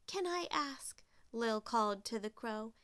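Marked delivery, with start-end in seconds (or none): 0.1-1.0 high, lightly voiced polite request; a hint of a courteous little character; polite Lil. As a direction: ask up to someone in a lifted voice. Voices Lil calling to crow.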